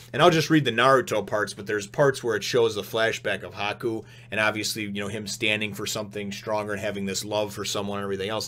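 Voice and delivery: ordinary talk, nothing marked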